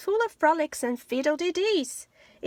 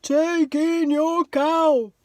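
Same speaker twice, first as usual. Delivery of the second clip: slow, drawn-out sage voice; a very slow, measured wise old man; 4 semitones higher, 7 dB louder, slower than usual. Acted. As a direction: speak slowly like a calm old sage. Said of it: "said the wise old man".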